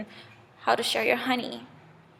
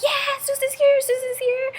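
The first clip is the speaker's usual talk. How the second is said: very high, gleeful bee chorus; excited cheer; 4 dB louder than usual. Acted. Bees welcoming Zeus, excited cheer. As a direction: squeal it in delight at an arrival.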